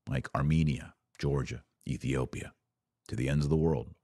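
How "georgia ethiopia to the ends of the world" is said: ordinary delivery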